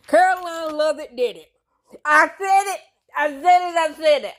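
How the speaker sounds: high, near-crying cry of vindication; triumphant squeal. Real, not acted. Telling viewers she predicted it, shrieking high. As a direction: shriek I told you so.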